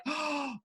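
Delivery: sharp gasp of mock surprise; mock surprised gasp. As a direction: gasp as if struck by a discovery. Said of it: "It's like".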